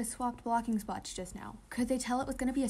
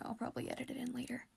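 whispering; 8 dB quieter, faster than usual. Real, not acted. Aside while dubbing anime clip.

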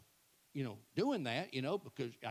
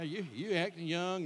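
ordinary talk, nothing marked

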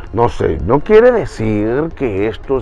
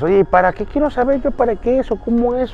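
1 imagined listener; 11 semitones higher than usual. Retold or imitated. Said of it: "Entonces tú dirás".

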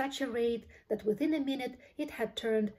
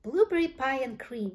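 higher, louder happy cry; excited shout; 4 semitones higher, 5 dB louder than usual. A narrator's reading. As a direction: call it out in delight. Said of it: "shouted Violet".

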